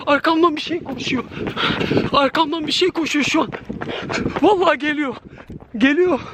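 frightened, pitched well up while fleeing; panicked high voice. Real, not acted. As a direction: blurt it out scared and running. Fleeing from something chasing him. (korkuyla tiz sesle)

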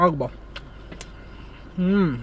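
chewing while talking; muffled through food; mouth full of pizza. Real, not acted. Seen 2: bites at -2, cheeks full and jaw chewing throughout.

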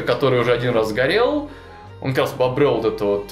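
ordinary talk, nothing marked

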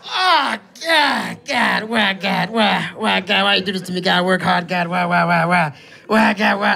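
lightly faked sobbing lament; a touch of over-the-top grief from the boss; mock crying. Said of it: "He's crying his ass off".

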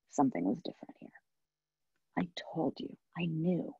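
soft unvoiced whisper; hushed I-told-you whisper. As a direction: breathe it out, vindicated. Mother confronts the resident.

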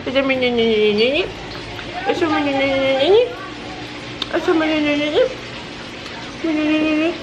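put-on high, cutesy baby voice; adoring baby. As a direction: gush as a besotted little one. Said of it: "Estoy como".

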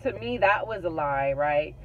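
ordinary delivery

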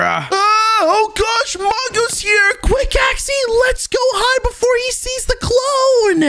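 high-pitched urgent panic; panicked alarm. Acted. Wudo warning Axie that Mongo's here, high panicked alarm. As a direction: raise the alarm, voice shooting up. Spots the enemy, must hide.

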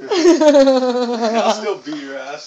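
laughing. Real, not acted. Laughing trash talk at darts.

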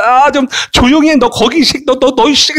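high, exasperated teacher telling off a pupil; mock scolding. Acted teacher scolding a noisy class.

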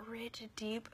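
soft whispered praise. Real, not acted. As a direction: whisper it intimately.

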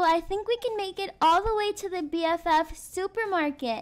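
ordinary talk

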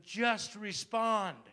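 drawn out, loud and high, each word weighted; slow emphatic command. Acted. Pastor urging his listeners to obey God, slow and loud. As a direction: land it slowly like a verdict.

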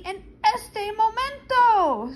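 put-on high, loud and slow, urgent child; child insisting loudly in the car. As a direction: stretch it out, desperate to go. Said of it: "I said loudly".

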